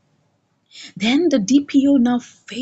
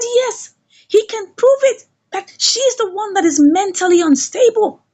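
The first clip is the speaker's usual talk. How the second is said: high voiced official; 8 semitones higher, 3 dB louder than usual. DPO voiced dismissing Flora.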